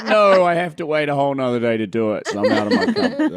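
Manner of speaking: high, loud comic wail; hammed-up loud lament; mock dismay. Acted dismay at postponed school speech.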